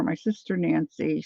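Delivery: normal talking voice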